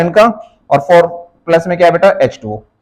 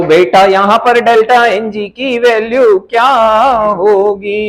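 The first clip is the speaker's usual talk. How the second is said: slow, stretched classroom prompt; drawn-out question; slower than usual. Real, not acted. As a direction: draw out every word as you quiz them. (शब्द खींचकर धीरे-धीरे पूछना)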